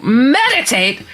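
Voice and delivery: incredulous echo. Retold or imitated. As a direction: stretch the word out slowly, baffled. Incredulous echo of friend's request.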